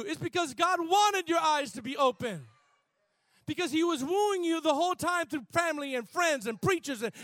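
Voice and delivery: loud, high, shouting preacher; full-force preaching. Acted.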